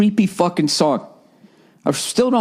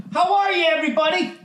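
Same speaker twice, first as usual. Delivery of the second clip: much higher, sing-song host welcome; mock cheery greeting; 14 semitones higher than usual. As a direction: greet the crowd like a chirpy TV host. Bright welcome to listeners.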